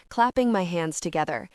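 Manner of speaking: plain reading voice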